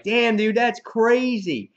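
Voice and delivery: loud, high stunned reaction; drawn-out astonishment, pitch up; amazed. Retold or imitated. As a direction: act out a blown-away reader. Acting the reader's amazed reaction.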